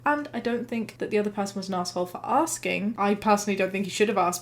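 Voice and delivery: normal talking voice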